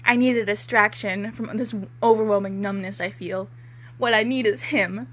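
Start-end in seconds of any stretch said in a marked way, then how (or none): none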